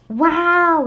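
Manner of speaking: loud high wow; excited wow. Acted. Kids shouting at the giant pot holder, amazed. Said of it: "we all shouted".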